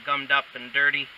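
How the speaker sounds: normal talking voice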